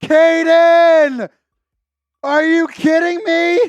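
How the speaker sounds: loud, drawn-out astonished exclaiming; loud disbelief. Real, not acted. Host told he must dye his hair, loud disbelief. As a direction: holler slowly in real stunned disbelief. Donation means dyeing hair purple.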